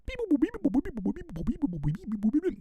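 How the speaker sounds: high mock robot beeping; robot beeps. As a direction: chirp like a tiny machine. Mocking future beats.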